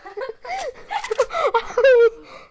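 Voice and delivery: loud, high laugh; high burst of laughter. Real, not acted. Laughs after apology.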